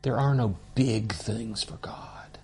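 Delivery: soft awed whisper. Real, not acted. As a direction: breathe it like a secret. Awed aside to the class.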